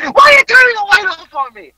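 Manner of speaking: high, fast, loud mock shout; retold angry yell. Husband to wife over the light, mock shouting. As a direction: imitate an indignant yell across the room.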